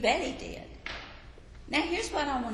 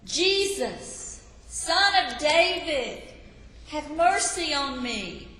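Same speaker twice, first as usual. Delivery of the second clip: higher, louder cry for mercy; 6 semitones higher, 5 dB louder, slower than usual. A narrator's reading. Blind beggar crying out to Jesus as he passes, loud plea. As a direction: call out desperately from the roadside. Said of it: "started yelling in a loud voice".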